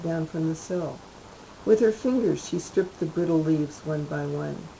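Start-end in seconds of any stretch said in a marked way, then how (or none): none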